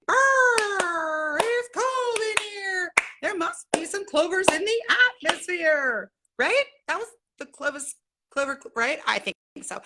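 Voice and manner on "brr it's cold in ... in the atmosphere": quoting a commercial. Retold or imitated. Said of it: "Like, I said".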